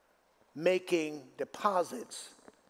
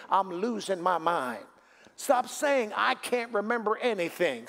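full-force preaching; 5 semitones higher than usual. Acted. Pastor exhorts the congregation about their words, preaching hard.

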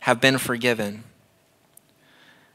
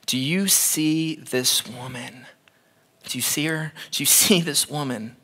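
quiet pressing question; 7 dB quieter than usual. Acted. Jesus voiced pressing Simon quietly.

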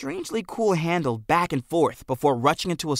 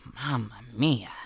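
soft, slow, lower faint groan; 3 semitones lower, 6 dB quieter, slower than usual. A narrator's reading. Jack mutters faintly as his pocket burns. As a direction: barely breathe it out in agony. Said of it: "uttered a faint".

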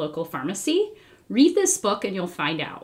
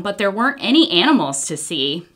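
usual speaking voice

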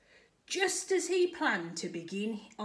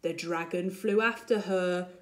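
plain reading voice

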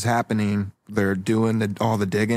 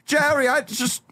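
high pleading voice; 13 semitones higher than usual. Acted. Dentist father pleading.

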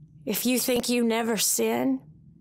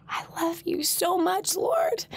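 tearful prayer; 6 semitones higher, slower than usual. Real, not acted. Emotional prayer to Lord.

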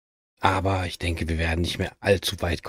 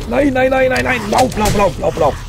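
panicked high shouting; being chased; 6 dB louder than usual. Real, not acted. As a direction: yell in panic while fleeing. Fleeing zombies in panic.

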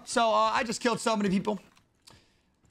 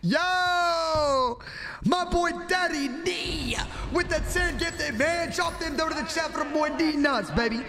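hype yell; 4 semitones higher, much slower than usual. Real, not acted. Streamer thanking Daddy D for gifted subs, yelling hype. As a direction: whoop it up for the donor. Hyped by big gift.

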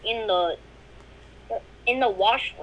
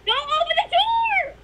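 high urgent yell; shouting warning; 13 semitones higher, 5 dB louder than usual. Real, not acted. Warning friend in game.

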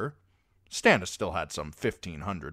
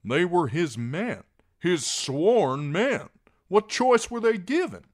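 raised, high, fervent plea; Davos, impassioned; 12 semitones higher, 9 dB louder than usual. A narrator's reading. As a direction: argue for them, building loud then easing.

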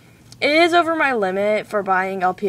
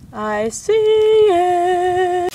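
excited; 10 semitones higher, 5 dB louder, much slower than usual. Real, not acted. Spotting the package toy.

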